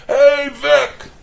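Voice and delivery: high, loud mock holler from a distance; call from the roof. Neighbor kid hollering down from the roof, loud high call. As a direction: call out his name loudly from up on a roof. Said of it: "I hear this voice".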